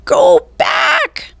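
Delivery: very high shrieked parrot call; squawking parrot. A narrator's reading. Parrot Polly squawks at the pirates. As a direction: screech it like a parrot. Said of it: "squawked Polly".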